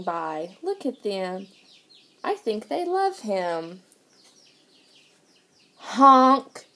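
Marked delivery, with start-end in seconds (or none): 5.8-6.7 loud truck-horn honk; yelled honk. A narrator's reading. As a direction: blare it like a big truck horn. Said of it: "yelled a dump truck".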